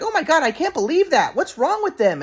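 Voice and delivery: high, appalled grandma; grandma shocked. Retold or imitated. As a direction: gasp it out like a scandalized grandmother.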